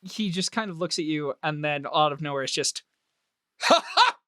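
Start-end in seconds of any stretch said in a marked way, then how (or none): 3.6-4.3 high sudden bark of laughter. Acted. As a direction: burst out laughing out of nowhere. Official bursts out laughing.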